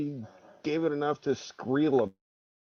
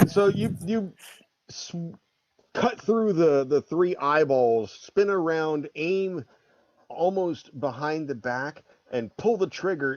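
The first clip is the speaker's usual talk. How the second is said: normal talking voice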